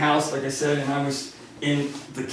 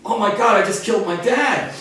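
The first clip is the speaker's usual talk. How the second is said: panicked thought; 6 semitones higher, 4 dB louder than usual. Retold or imitated.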